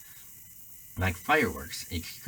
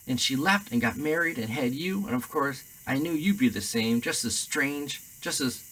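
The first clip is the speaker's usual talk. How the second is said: higher, bitter, rising rant; ranting aunt; 6 semitones higher, 3 dB louder than usual. A narrator's reading. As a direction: pour out years of resentment, shrill. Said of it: "went ranting on".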